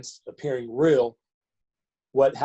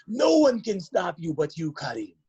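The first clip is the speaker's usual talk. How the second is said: put-on higher, emphatic Nigerian-accented maxim; Mister Appa; 5 semitones higher than usual. Teammate's father teaching a player his motto, Nigerian accent. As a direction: drive it home in a Nigerian accent. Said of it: "I'm gonna do the little accent".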